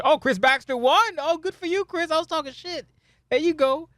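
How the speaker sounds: high-pitched real surprise; squeaky congratulations on unexpected news; pleasantly surprised. Real, not acted. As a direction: jump up high with delighted surprise. Reads a result he didn't expect.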